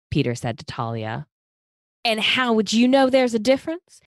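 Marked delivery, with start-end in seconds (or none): 2.0-4.0 high, louder Southern belle snap; snapping Southern belle. Acted. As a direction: snap back in a sweet Southern belle accent. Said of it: "Madison snapped at Peter".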